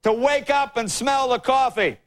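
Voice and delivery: high, slow, drawn-out mock oratory; worked-up Al Gore. Politician lecturing Congress in a speech, worked-up delivery. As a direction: imitate a politician worked up at the podium. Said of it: "he got pretty worked up".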